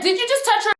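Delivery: high-pitched retold challenge; said loud. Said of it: "And I said it loud".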